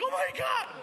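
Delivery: high-pitched mock panic; hammed-up terrified yelp; freaking out. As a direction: squeal it in exaggerated alarm. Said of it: "I'm freaking out".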